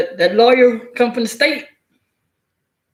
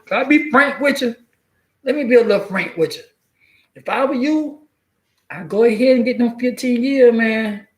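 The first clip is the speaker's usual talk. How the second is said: higher, wheedling public defender; pushy state lawyer; 4 semitones higher than usual. Retold or imitated. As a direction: talk him into the plea deal, higher. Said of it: "they gonna scare you".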